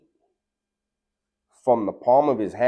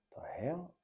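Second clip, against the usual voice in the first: breathless whispered what the hell; shocked whisper; 7 semitones higher, 17 dB quieter than usual. Retold or imitated. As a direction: whisper it in stunned disbelief. Stunned after Cooks's touch.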